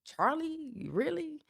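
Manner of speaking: softly, as if you can't believe the praise; stunned disbelief. Retold or imitated. Retelling her reaction to the voice note, in hushed disbelief. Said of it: "And I'm like".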